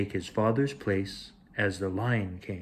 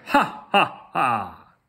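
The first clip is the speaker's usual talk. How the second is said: bold laugh; 4 dB louder, much slower than usual. Acted. Cub laughing boldly.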